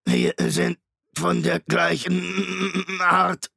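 laut, langsam, höher, leiernd wie ein kaputtes Tonband; leiernde Maschinenstimme. A narrator's reading. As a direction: zieh den Namen wie ein defektes Band. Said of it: "Die Stimme leierte und knackte wie ein beschädigtes Tonband". (Shrike spricht Hester an, leiernd und knackend wie ein Tonband)

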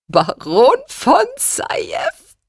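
breathless whisper, very high and slow; mother gasps it out, weak with laughter; gasping. Acted. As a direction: wheeze it out through laughter. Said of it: "ächzte sie".

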